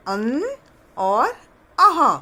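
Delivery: अन short, and अह called out loud and high; naming the vowels. Shown as a demonstration. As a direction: pop अह out loud. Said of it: "स्वर में क्या आता है?".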